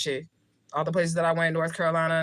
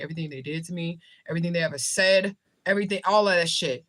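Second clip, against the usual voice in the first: ordinary talk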